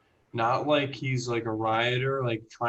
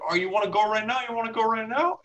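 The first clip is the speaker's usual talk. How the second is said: higher, mock-aggressive challenge; stoner kid picking a fight; 9 semitones higher, much faster than usual. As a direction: egg him on like a cocky stoner. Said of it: "the stoner kid who's like".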